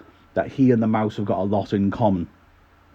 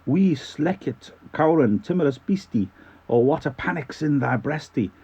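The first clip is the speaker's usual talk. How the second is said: amateur Scottish poetry recital; Scottish accent; 3 semitones higher than usual. Acted. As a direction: recite dialect verse in a bit of a Scots accent. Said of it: "in a bit of a Scottish accent".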